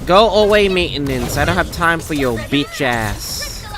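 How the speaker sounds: ordinary talk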